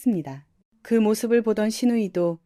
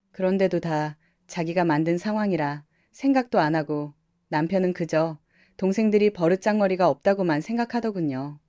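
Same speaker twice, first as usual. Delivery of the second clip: ordinary delivery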